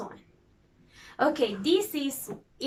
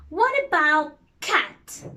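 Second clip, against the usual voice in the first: ordinary talk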